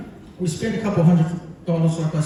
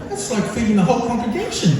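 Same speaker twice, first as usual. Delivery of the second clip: laughing; 8 semitones higher than usual. Real, not acted. Laughing at mum's demand.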